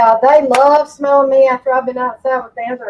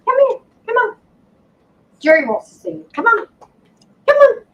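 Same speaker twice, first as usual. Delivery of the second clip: coaxing dog voice; 10 semitones higher, 4 dB louder than usual. Real, not acted. Calling the dog over.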